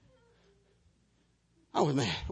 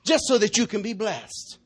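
raised, higher preaching voice, slowing to stress the point; full-force preaching; 8 semitones higher, 9 dB louder, slower than usual. Acted. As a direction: preach it out to the whole room. Preaching to the congregation.

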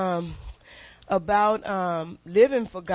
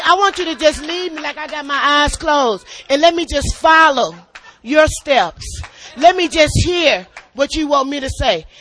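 raised, loud, pleading preaching; loud preacher's plea to God; fervent preaching; 5 semitones higher, 5 dB louder than usual. Real, not acted. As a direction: cry out to the Lord with rising fervour. Preaching with rising fervour.

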